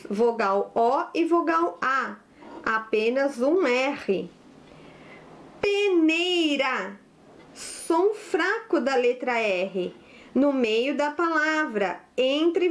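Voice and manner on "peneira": "Peneira" drawn out syllable by syllable; slow dictation. Shown as a demonstration. Teacher gives pupils the next word, slow dictation. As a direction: stretch each syllable of "Peneira".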